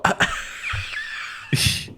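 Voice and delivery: breathy burst of laughter; laughing. Real, not acted. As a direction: laugh at yourself. Laughs at own slip.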